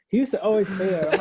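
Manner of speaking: normal talking voice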